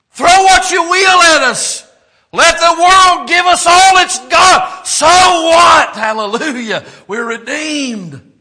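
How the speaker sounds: loud, high defiant shouting of "So?"; full-force preaching. Acted. Pastor defying trials before the congregation, shouted preaching. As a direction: shout back at every trouble.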